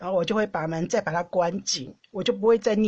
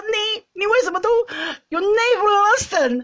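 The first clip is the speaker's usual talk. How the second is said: furious retold yelling; 11 semitones higher, 4 dB louder than usual. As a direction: re-enact blowing up at him in English. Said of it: "暴怒".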